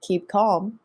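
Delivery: podcast host outro; show sign-off. Acted. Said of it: "signing off the show".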